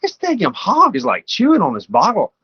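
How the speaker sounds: squeaky, amazed inner voice; a leap up high in bewildered wonder; high-pitched disbelief. Retold or imitated. Hunter in the woods, puzzled by the noise: high amazed voice. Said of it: "And I was like".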